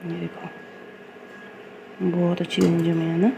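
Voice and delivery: slow, drawn-out admiration ending on a rising ना; drawn out admiring. Real, not acted. Cook admiring her curd to viewers, slow and drawn out. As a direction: savour each word as you admire it.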